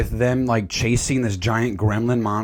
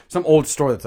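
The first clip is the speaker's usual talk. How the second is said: ordinary talk, nothing marked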